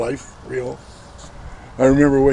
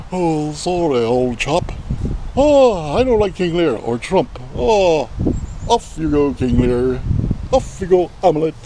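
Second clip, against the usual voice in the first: put-on British accent. Speaker imagines William Shakespeare alive today, in a mock British accent. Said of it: "Wrong accent".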